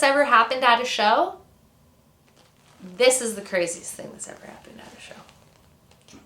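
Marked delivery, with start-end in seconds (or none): 3.0-6.2 whispered, confiding build-up to a story; hushed emphasis. Real, not acted. Storyteller announcing the craziest show story, hushed and whispered. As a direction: whisper it like a big reveal.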